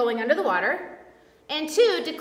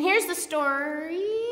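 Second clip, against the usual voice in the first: slow, stretched "story" rising at the end; drawn-out lead-in; 4 dB quieter, much slower than usual. Real, not acted. Preacher stalling before the Bible passage, "story" drawn out and rising. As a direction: stretch out "story" and leave it hanging upward.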